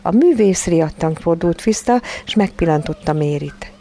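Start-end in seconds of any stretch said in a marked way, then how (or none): none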